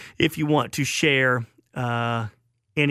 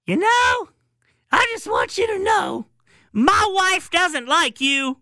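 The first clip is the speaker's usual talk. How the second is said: put-on much higher, squeaky old-man voice, drawn out slowly; Joe Paterno impression; 4 dB louder, slower than usual. Host imitating the old coach needling a reporter, high squeaky voice. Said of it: "and Joe looks at him and says".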